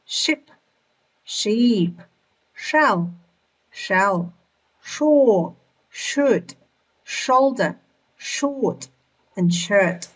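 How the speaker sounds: "ship", "sheep", "shore" one at a time with clear "sh"; SH word drill. Shown as a demonstration. Teacher drills the sh sound for learners, isolated careful words. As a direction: model each "sh" word alone, pausing between. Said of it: "combination that sounds like sh".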